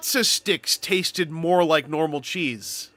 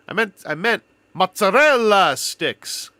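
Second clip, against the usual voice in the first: slow, mock-Canadian "mozzarella" for the captions; Canadian accent; 3 semitones higher, 5 dB louder, slower than usual. Shown as a demonstration. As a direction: lean lightly into a Canadian accent. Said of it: "If I say it with the accent".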